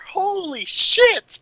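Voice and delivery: drawn-out, high-pitched exclamation; out-of-character shout. Retold or imitated. Imitating an actor breaking character on set, high drawn-out shout. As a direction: imitate a stunned, slow high cry. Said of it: "shout out of character".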